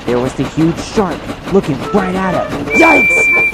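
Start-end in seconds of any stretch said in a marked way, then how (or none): 2.7-3.5 high, loud yelp of alarm; startled cry. A narrator's reading. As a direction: cry out in sudden fright. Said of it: "Percy cried".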